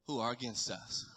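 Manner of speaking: low, hushed, with long pauses; unhurried reassurance from someone barely awake; sleepy Elijah. Acted. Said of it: "wiping sleep from his eye".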